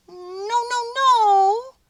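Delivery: a tiny mouse squealing in dismay; squeaking mouse protest. A narrator's reading. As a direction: mouse finding the statues set up again. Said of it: "squeaked Mortimer".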